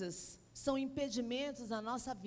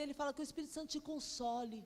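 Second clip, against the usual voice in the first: ordinary talk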